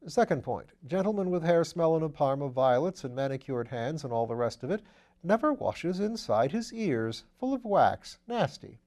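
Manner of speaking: lightly voiced upper-class gent; airy, amused aristocrat; breezy gentleman voice. A narrator's reading. Wimsey's voice in the reading.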